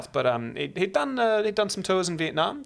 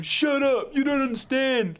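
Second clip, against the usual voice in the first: put-on high, whining teen outburst; mock teenage tantrum; 10 semitones higher, 3 dB louder, slower than usual. Teenager snapping back at parents, imitated high tantrum. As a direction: play a stroppy teenager, pitched way up. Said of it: "had my parents said that to me".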